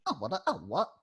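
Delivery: Oh, what? scratched with sharp pitch swoops; vocal scratch. Shown as a demonstration. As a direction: scratch the words like a turntable. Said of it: "the magic part of the scratch".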